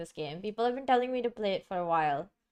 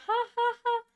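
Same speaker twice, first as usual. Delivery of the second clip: high, spaced-out ha-ha laugh; high-pitched little laugh at oneself; 14 semitones higher, faster than usual. Real, not acted. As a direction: laugh it off in high, separate ha's. Laughs at forgotten loud volume.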